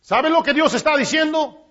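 normal talking voice

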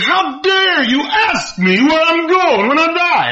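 high, drawn-out mock indignation; angry outburst. Pastor angered by the preacher's question, indignant outburst. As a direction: act out an offended man, stretching the words high. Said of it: "never saw a man get so angry".

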